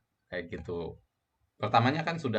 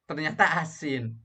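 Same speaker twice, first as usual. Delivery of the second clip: higher pitched, mildly surprised "ternyata asin"; lightly surprised cook finding the food salty; tasting surprise; 6 semitones higher than usual. Shown as a demonstration. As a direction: react to the salty taste with a light start. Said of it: "Misalnya begitu dicicipin".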